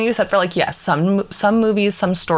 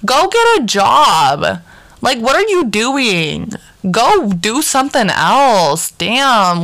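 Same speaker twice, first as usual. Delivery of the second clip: raised, high, drawn-out mock outburst; exasperated scolding; 5 semitones higher, 3 dB louder, slower than usual. Viewer telling off a grown man racing kids, exasperated. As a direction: stretch each word out in playful exasperation.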